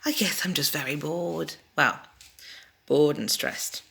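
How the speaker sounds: whispered, bored confession; a soft whispered aside. Real, not acted. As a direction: drop to a whisper as if admitting it.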